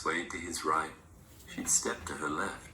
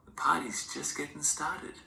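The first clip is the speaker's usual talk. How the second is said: grandma's whisper; 9 semitones higher than usual. A narrator's reading. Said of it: "she'd say".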